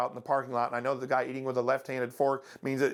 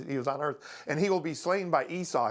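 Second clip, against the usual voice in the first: ordinary talk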